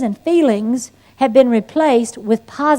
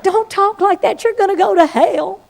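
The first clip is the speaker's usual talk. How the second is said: higher, prim little-sister warning; sweet sister scolding; 7 semitones higher than usual. Retold or imitated. Sister warning her about going to hell, prim and high. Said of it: "She's like".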